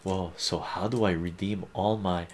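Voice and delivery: usual speaking voice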